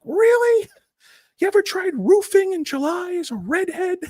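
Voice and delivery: very high, sarcastic squeal; mocking retort. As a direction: squeak it out snidely. Mock rebuttal to Bill Burr.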